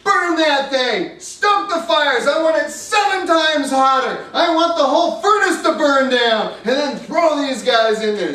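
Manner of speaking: king ordering the furnace hotter, screaming in rage; insane with rage. Acted. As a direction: bellow orders like a tyrant gone mad. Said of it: "He was insane with rage".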